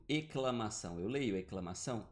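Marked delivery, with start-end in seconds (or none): 0.1-1.0 "exclamação" said as "eclamação"; dropped S. Shown as a demonstration. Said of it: "Eu não leio exclamação". 1.4-2.1 "exclamação" as "eclamação", questioning rise; dropped S. Shown as a demonstration. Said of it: "eu não leio exclamação".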